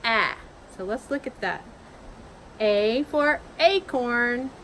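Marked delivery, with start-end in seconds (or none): 0.0-0.3 A said short, as in apple; short vowel A, æ. Shown as a demonstration. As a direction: clip A short like the start of apple. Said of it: "its short sound is A".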